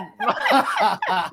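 very high burst of laughter; high shriek laugh. Real, not acted. Cracking up at answer.

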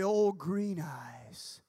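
slow, drawn-out spooky name; spooky storyteller. Retold or imitated. Naming the campfire monster, slow spooky drawl. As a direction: stretch it out like a ghost story.

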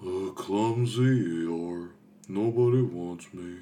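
very slow, mopey donkey voice; gloomy donkey. Retold or imitated. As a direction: drag it out, sorry for himself. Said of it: "you also got".

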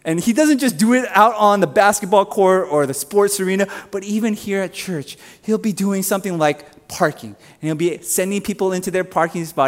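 ordinary talk

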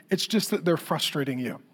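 ordinary talk, nothing marked

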